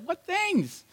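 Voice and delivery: high, put-on innocent voice; playing dumb. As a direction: ham up the clueless stranger. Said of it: "permission to play dumb".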